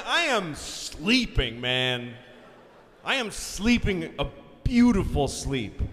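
slow, loud, drawn-out declaration; comic grandiose emphasis. Acted. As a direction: stretch each word with grand relish. Dramatizes how deeply he was sleeping.

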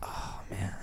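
breathy whispered sigh. Pretend. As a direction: whisper it in awe. Joking reply after sex change.

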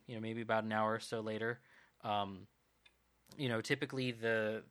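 ordinary delivery

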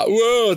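loud, high "whoo" back to the crowd; short mimicked stage holler; imitated shout back. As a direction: holler back like rousing an audience. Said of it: "då kan man liksom säga".

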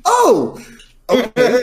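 high, loud drawn-out "oh"; mock realization. Retelling his reaction to daughter.

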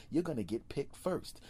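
ordinary talk

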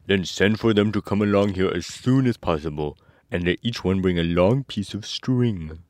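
lightly voiced scarecrow giving orders; a hint of an instructing scarecrow. A narrator's reading. Scarecrow directing the mouse queen, lightly put-on voice.

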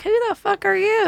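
high, startled reaction; shocked. Retold or imitated. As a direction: react like a total stranger greeted you. Her own retold reaction.

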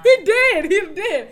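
laughing, loud, high he did; laughing insistence. Real, not acted. Confirming to her friend, loud laughing insistence. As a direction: blurt it out through a laugh.

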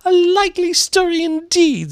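very high, loud scoff; contemptuous pigeon voice; deepest contempt. Acted. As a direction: screech it with scornful disbelief. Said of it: "in a tone of the deepest contempt".

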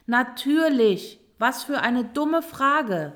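huffy, scornful emphasis; indignant. A narrator's reading. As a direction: dismiss it emphatically as obvious. Turtle scorns the rat's question.

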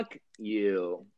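soft, dismissive curse; quiet fuck you. Shown as a demonstration. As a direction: toss it off softly. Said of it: "throw up the finger".

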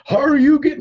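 playful mock combat yell; mock fighting-game shout. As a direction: shout it like a Street Fighter move. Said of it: "she like".

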